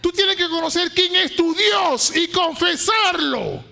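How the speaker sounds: fiery sermon climax; preaching at full force. Acted. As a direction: thunder it to the congregation.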